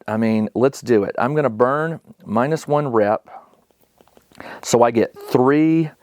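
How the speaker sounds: ordinary talk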